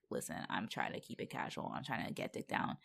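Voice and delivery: soft, whispered confession; whispered aside. Retold or imitated. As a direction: whisper it low and secret. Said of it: "she did tell him, like".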